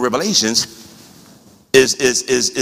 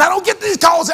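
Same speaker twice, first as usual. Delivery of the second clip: high-pitched protest. Real, not acted. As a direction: exclaim it way up high. First of an exclaimed repetition.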